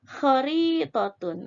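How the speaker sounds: Khoritotun said slowly, each syllable clear; slow dictation. Shown as a demonstration. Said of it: "ada tun atau tak marbutoh".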